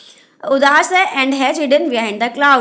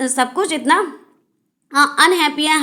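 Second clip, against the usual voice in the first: ordinary delivery, nothing marked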